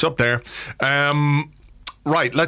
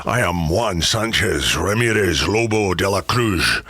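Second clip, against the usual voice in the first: mock-grand Scottish movie swordsman; Sean Connery; 5 semitones lower than usual. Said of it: "Sean Connery coming in and doing his whole".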